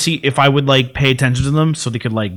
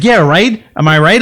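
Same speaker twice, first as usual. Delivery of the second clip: eager aunt; 6 semitones higher, 7 dB louder than usual. Retold or imitated. Aunt seeking agreement.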